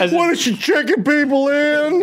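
mock shocked. Friend gasping over a hotel-working mom in mock shock. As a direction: gasp like a scandalized gossip.

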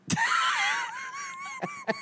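high wailing laugh, almost a cry; shrieking laugh. Real, not acted. A high shrieking laugh at his own joke. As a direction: let the laugh break up high and squealing.

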